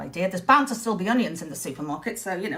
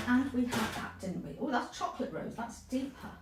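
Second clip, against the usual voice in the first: muttering; 9 dB quieter than usual. Real, not acted. Muttering while searching paints.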